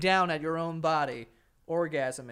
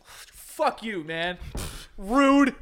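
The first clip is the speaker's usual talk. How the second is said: mock indignant girl; 5 semitones higher, 4 dB louder, much slower than usual. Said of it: "She's like".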